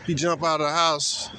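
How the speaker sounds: ordinary talk, nothing marked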